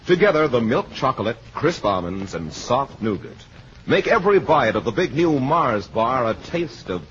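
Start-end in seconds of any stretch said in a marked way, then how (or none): none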